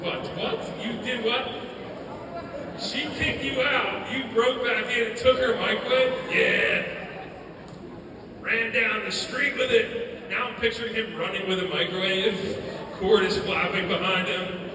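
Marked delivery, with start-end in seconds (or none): 0.0-6.1 loud, high disbelief, building; incredulous questioning. Retold or imitated. Speaker questions brother about stolen microwave, loud disbelief. 6.3-10.3 loud, drawn-out cartoonish boast; brother's Rick voice. Brother admits microwave getaway, loud Rick-like voice. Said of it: "his story sounded like that".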